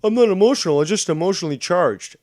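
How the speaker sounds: high, loud whiny parody of her; shrill-ish mock quote of the caller; mocking imitation. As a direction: squeal her words back at her. Mocks Shannon's denial.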